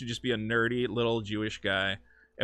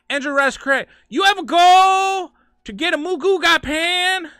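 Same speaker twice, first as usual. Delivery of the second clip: Andrew Rice Clay; 9 dB louder, slower than usual. Pretend. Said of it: "this is a character I do".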